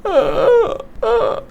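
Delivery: high scared yelp; high startled aa; startled cry. Real, not acted. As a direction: yelp in sudden fright. Startled by darkness.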